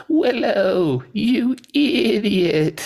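high, drawn-out bleating goat. Acted. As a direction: bleat it out slowly like an exasperated goat. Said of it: "imagine that somebody's a goat saying this".

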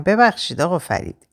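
usual speaking voice

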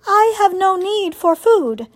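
high, evenly pitched robot. Acted. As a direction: state it as an unemotional robot. Robot reassures the animals.

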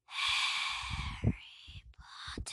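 very slow loud hissed whisper; Voldemort's voice. Acted. Voldemort calling Harry, slow menacing hiss. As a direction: drag it out menacingly. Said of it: "the voice of Lord Voldemort".

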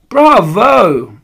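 high, loud drawn-out cheer; exultant shout of applause; yelling audience. A narrator's reading. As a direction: yell it like a delighted crowd. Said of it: "yelled the audience".

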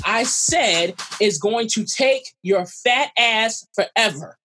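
slow, emphatic indignant retort; imitating an indignant man talking very slowly. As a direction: spell it out slowly, word by word, offended. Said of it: "he got indignant and he slowed it down".

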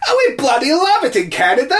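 high, laughing fan voice; mock Canadian fan. Host voicing Canadian fans gushing over the song. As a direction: gush like a delighted fan.

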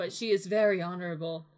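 formal storyteller delivery; narrator voice. Acted. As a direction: read it like the narrator. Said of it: "I was in my narrator voice".

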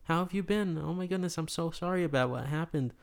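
high, effusive retold greeting; flustered greeting. Burger guy greeting the returning widower, flustered and apologetic. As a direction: gush with concern, pitched well up.

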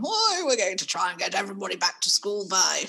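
lightly voiced Boris Johnson; a hint of a pompous prime minister announcing; Boris impression. Mum mocking the prime minister's announcements, lightly voiced. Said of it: "my excellent impression".

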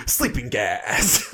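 drawn-out villain's gotcha; Green Goblin. Retold or imitated. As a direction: stretch it out slowly like a cartoon villain. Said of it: "and then he goes".

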